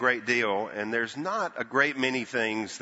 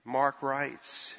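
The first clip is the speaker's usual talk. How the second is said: usual speaking voice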